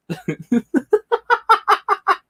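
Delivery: long squealing laugh; helpless high laughter; wheezing falsetto giggle; high cry-laugh. Real, not acted. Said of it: "I'm crying, guys".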